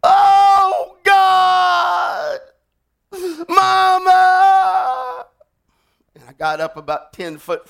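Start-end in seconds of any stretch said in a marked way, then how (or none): 0.0-5.2 very high, loud anguished cry; screaming for mama. Retold or imitated. Wounded kid screaming for his mother, anguished shout. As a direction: wail it like a dying boy. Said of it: "he began to scream".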